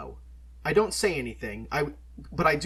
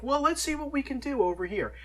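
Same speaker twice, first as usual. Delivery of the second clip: Dave voiced; 12 semitones higher than usual. Shop owner Dave voiced.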